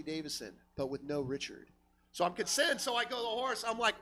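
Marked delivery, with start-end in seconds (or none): none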